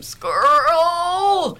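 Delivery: imitating a stroke patient forcing out the word, high and loud; effortful first word. Stroke patient finally forcing out her first word, high and loud.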